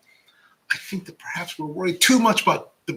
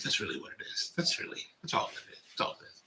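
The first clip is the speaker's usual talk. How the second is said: whispered aside; 6 semitones lower, 11 dB quieter, faster than usual. Real, not acted. Unvoiced aside.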